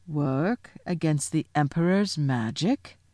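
slow, measured lion's rebuke; stern, deliberate incredulity; grave disbelief. Acted. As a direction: question it slowly, with stern weight. Aslan rebukes Susan's suggestion.

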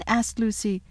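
usual speaking voice

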